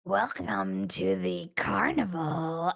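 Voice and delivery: loud, slow, drawn-out clown greeting; carnival clown. Acted. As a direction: announce it like a creepy carnival clown. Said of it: "it's the voice of that clown".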